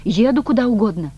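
loud, high, wholehearted cry; forceful exclamation. A narrator's reading. Woman seizing her chance for news, exclaiming forcefully. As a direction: declare it with abandon. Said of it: "с силой воскликнула Маргарита".

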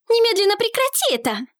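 high, urgent cry to stop; shouting. A narrator's reading. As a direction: yell it out sharply. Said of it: "закричала она на Эллалиана".

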